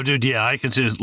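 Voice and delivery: ordinary talk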